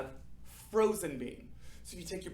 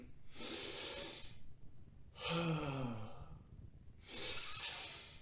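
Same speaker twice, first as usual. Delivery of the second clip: long soft breathy sigh out; 9 dB quieter than usual. Shown as a demonstration. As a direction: let all the air go in a sigh. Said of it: "we're gonna do that five times".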